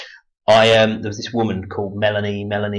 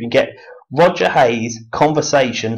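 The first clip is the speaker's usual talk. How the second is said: ordinary talk, nothing marked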